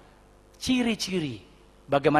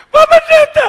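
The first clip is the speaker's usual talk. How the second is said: very high, loud hysterical cry; 14 dB louder than usual. Retold or imitated. Wife cries out to the pastor, hysterical. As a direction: imitate a hysterical woman wailing out. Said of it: "Wih, histeris lagi".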